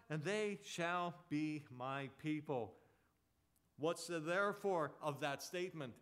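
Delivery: emphatic scripture proclamation; forceful preaching. Real, not acted. Preacher quoting God's promise to his people, forceful preaching. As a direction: declare God's promise with conviction.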